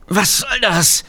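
pained high groan of a question; strained and high, caught in a grip; groaning thief. A narrator's reading. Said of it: "ächzt der Dieb".

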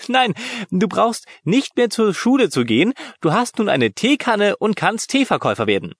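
mock him through tears of laughter; laughing. A narrator's reading. Lena laughing at her brother, teasing. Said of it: "Tränen in den Augen und hielt sich den Bauch".

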